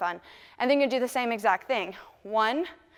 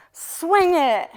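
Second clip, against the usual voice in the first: higher, louder call of the lyric; 6 semitones higher, 5 dB louder than usual. Retold or imitated. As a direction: sing out the cue like the singer. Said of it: "and he says".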